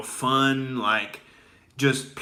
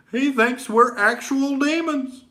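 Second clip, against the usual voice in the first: higher mock Obama voice; imitating Obama; 11 semitones higher than usual. Obama mocking the radio host, higher imitation. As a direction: do a light send-up of Obama's measured delivery. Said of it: "He's like".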